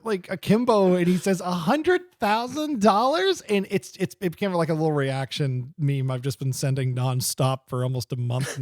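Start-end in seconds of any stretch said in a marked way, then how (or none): 1.4-3.4 slow, higher, dazed question; glazed-over mock Alvin. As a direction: stretch it out in wonder, eyes glazed. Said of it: "glazed over".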